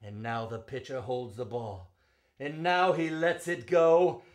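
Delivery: rising and falling ballad read, full of drama; spirited verse reading; dramatic poem recitation. Acted. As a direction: recite it like a storyteller at the ballpark.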